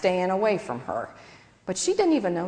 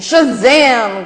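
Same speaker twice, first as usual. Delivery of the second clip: loud high yell; shout; 5 semitones higher, 12 dB louder than usual. A narrator's reading. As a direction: shout it like a superhero. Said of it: "Gladys yelled".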